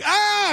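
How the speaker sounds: imitating a nephew's high panicked yelp; a sudden shrieked ah from the passenger seat. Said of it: "he'd be like".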